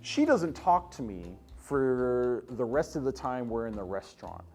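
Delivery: ordinary talk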